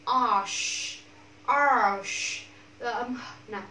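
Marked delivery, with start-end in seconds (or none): 0.1-2.4 "Arsh" with a long, rounded sh; loud model "Arsh", r gliding into sh; pouted sh. Shown as a demonstration. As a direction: push the lips out for the sh in "Arsh". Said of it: "chu miệng luôn".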